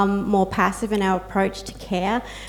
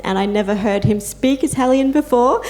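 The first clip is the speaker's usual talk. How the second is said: normal talking voice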